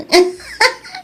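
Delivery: high excited giggle; shy giddy giggle. Acted. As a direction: giggle like a girl with a crush. Giggles confessing her crush.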